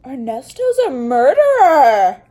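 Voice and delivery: loud, high outraged exclamation; shocked outburst. Real, not acted. Vlogger outraged at the villain on screen, loud shocked outburst. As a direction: blurt out the shock.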